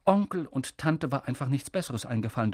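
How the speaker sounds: ordinary delivery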